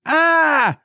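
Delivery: high held falsetto cry; quick imitation of a death scream; cartoonish high "aah"; mock scream. Replays the comic's scream.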